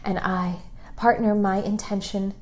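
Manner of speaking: soft, hushed affirmations, drifting into whispers; guided meditation. Acted. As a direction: lead them gently into self-love, half whispering.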